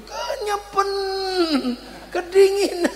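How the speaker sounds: high whiny mock sob; a hammed-up child's cry; imitating crying child. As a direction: whimper like a cold, wet little kid. Child crying after cold bath.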